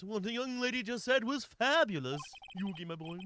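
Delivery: affected cartoon villain voice; Pegasus. Villain talks to Yugi, Pegasus impression. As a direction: play the villain addressing his young rival. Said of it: "I'm just gonna do Pegasus".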